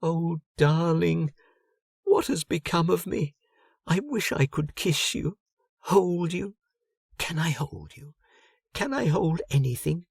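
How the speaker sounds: a ghost pleading to touch her husband; yearning ghost Helga. Acted.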